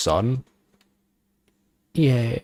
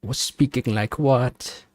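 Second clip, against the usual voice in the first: usual speaking voice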